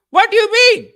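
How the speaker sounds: high, loud indignant outburst; hammed-up angry yell; mad uncle. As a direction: cut in shouting, like a pissed-off uncle. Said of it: "he's, like, getting mad".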